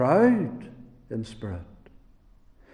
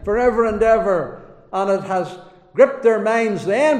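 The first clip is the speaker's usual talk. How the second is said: louder, urgent sermon at full force; fiery preaching; 4 semitones higher, 6 dB louder than usual. Acted. Preacher warning sinners, in a louder urgent preaching voice. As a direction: drive the warning home to the congregation.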